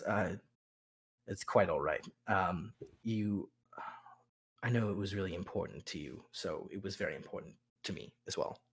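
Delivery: a wincing lord who plays down his pain, low and soft; hurting lord. Acted. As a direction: lord with a back injury reassuring his wife, low and hushed. Wounded lord answers his wife.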